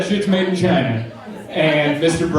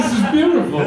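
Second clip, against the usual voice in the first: high, helplessly amused retelling; overcome with amusement; 6 semitones higher, faster than usual. Retold or imitated. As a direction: squeeze it out as if through laughter. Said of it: "That's all I could get out".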